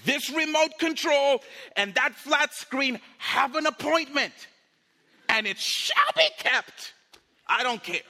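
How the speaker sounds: loud, high shouted anger; acted furious defiance. Angry person about to wreck the flat screen, shouting. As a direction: rage it out, landing each phrase hard. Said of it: "I'm so mad right now".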